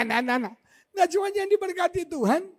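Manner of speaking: ordinary talk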